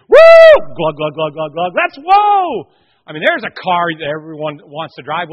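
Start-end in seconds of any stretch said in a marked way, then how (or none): 0.1-0.5 piercing, very loud "woo"; high loud whoop. Acted. Preacher acting out Peter as a wild car, piercing whoop. 0.7-1.7 loud, rhythmic glugging; engine glugging imitation. Preacher acting out Peter as a car, loud glugging. 1.8-2.7 very high, very loud whoop; wild yell. Acted. Preacher showing off Peter as a wild car, high yell.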